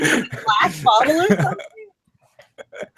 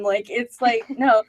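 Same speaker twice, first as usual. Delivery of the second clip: ordinary delivery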